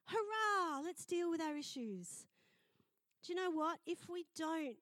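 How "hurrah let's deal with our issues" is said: high, loud hurrah, drawn out; hammed-up jubilant cheer; mock cheering. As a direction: pretend to be thrilled, slowly. Acts out mock cheering again.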